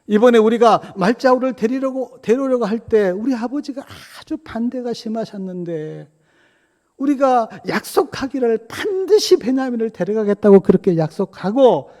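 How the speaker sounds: high, loud, urgent appeal; impassioned retold entreaty at high pitch; acted pleading. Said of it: "애원하면서 요셉을 붙들고 이렇게 이야기합니다".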